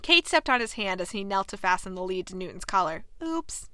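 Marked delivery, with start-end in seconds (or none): 3.2-3.7 small, higher, softer fake oops; mildly phony little apology; insincere. A narrator's reading. Kate after stepping on his hand, insincere. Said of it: "she said rather insincerely".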